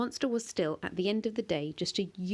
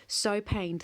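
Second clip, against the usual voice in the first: ordinary delivery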